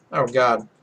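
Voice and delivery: usual speaking voice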